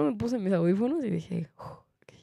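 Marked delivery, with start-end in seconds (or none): 1.6-2.1 whispered self-calming; soft whispered reassurance to self. Real, not acted. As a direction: whisper to yourself to stay calm. Calming herself with headphones on.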